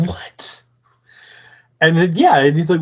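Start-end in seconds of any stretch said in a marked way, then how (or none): 0.1-0.6 a breathless, whispered what; stunned whispered what. Retold or imitated. Tutor baffled by her bad-girl talk, whispering. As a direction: barely breathe the word out.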